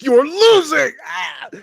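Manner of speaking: high, loud strained scream; recreated scream. Retold or imitated. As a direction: scream it like the dub take. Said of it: "that scream where it's like".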